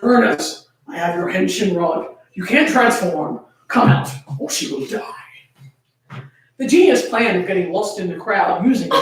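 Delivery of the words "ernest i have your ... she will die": low, hushed demon threat, whisper at the end; quiet deep demon. Acted. Demon threatens the hidden Uranus, low and hushed. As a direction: menace the hero softly, dropping to a whisper. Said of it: "the demon spoke with a quiet but deep voice".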